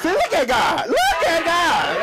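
high, loud, laughing praise; excited shout. Real, not acted. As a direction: shout it out in joy. Celebrating being a Leo.

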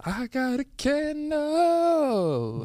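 very slow, high put-on voice; silly slow voice. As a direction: drag each word out in a goofy high voice.

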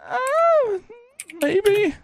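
high, hesitant "maybe"; unsure moderator. Pretend. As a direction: waver like you can't decide. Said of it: "I'd be like".

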